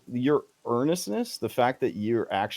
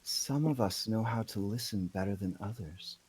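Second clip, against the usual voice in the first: soft, confiding character voice; conspiratorial; 7 dB quieter than usual. Acted. Woman leaning in to Toothless, soft conspiratorial tone. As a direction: drop the volume as if sharing a secret. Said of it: "leans in conspiratorially and she says".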